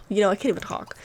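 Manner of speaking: ordinary talk, nothing marked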